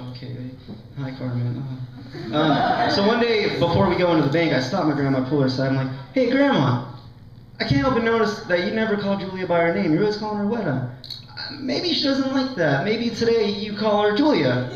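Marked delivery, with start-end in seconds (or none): 0.0-1.6 a much softer, reluctant hello; grudging greeting. Retold or imitated. Teller reluctantly greeting the grandmother, soft and grudging. 6.1-14.7 gentle coaxing. Retold or imitated. Speaker gently confronts her grandma.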